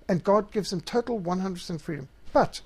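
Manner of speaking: ordinary delivery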